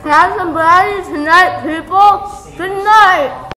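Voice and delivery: loud, high, eager yelling; excited shout. Real, not acted. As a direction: shout it out to the room. Kid yelling a request.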